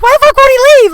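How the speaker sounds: high, loud frantic cry in her head; panicked inner yell. Retold or imitated. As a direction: yell it inside, desperate for him to go. Panicked thought, guest won't leave.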